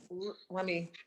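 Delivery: talking through a mouthful; words round a bite of food; mouth full. Real, not acted. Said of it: "I've got my mouth full".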